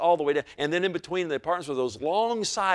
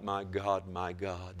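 ordinary talk, nothing marked

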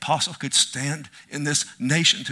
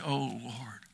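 soft prayer sigh; 4 semitones lower, 12 dB quieter than usual. Acted. Quiet prayer cry.

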